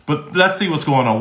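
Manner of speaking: normal talking voice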